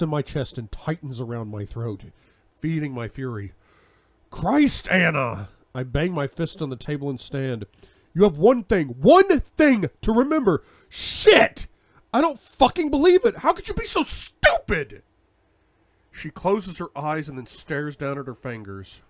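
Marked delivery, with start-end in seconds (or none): 4.3-5.5 loud, high furious shout; angry shout. Acted. Bangs fist, furious outburst. 8.1-15.0 much louder and higher raging yell; furious yelling. Acted. Furious tirade at Anna.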